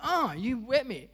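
put-on a playful childish protest; mock outraged kid. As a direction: squeal it like a kid who just got splashed. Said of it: "I'll be like".

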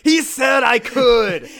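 loud, high protest over the laughter; hammed-up shout of defence at the casket; mock indignant shout. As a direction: yell it like you've been caught but you're in the right.

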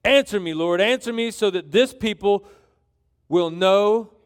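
loud, emphatic plea to God; acted prophet's public prayer, projected; fervent prayer. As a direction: call out boldly to the Lord.

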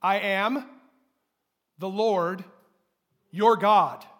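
loud, deliberate declaration, YOUR hit hardest; proclaimed loudly. Shown as a demonstration. Pastor leading the congregation's response, loud proclamation. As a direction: ring out each word for the church to echo. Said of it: "Say it with me".

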